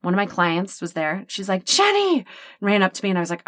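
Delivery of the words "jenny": high, loud cry of her name; excited call. Retold or imitated. Client spotting her in Whole Foods, excited call. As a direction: call out her name across the store with delight.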